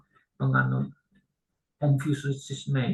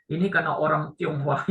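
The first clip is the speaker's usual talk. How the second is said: ordinary talk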